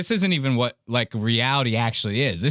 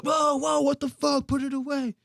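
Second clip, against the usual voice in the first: high-pitched comic panic; mock alarm; 13 semitones higher, 3 dB quieter than usual. Comic voices everybody seeing the monster, mock alarm. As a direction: recoil in exaggerated alarm, much higher. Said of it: "Everybody's like".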